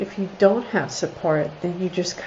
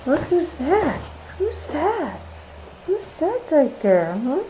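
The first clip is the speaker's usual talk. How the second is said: much higher sing-song voice for the dog; 8 semitones higher than usual. Real, not acted. Baby talk to dog on camera.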